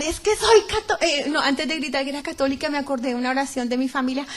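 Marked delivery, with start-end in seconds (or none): 0.0-1.0 high-pitched retold shout, cut short; retold cry. Woman crying out for rescue from the pit, retold shout. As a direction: call out desperately, higher pitched. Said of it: "empezó a gritar".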